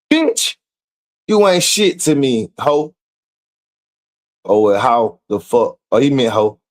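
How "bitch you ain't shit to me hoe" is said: high, sharp mock insult; mock furious reply. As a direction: spit it out like her text. Reading her insulting reply.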